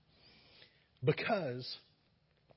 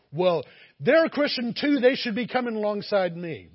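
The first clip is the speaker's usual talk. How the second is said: higher and much louder, whiny protest; indignant objector; 7 semitones higher, 13 dB louder than usual. Retold or imitated. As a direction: protest like you're owed it.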